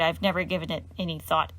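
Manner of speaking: usual speaking voice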